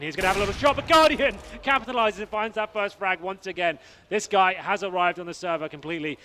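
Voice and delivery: rising loud hype call; excited play-by-play. Real, not acted. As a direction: build to a big shout. Caster hypes big frag.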